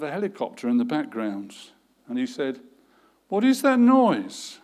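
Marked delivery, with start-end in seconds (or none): 3.3-4.6 higher, louder, slower alarmed boss; alarmed question. A narrator's reading. Boss alarmed by a helicopter on the line. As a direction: demand it, startled and worried.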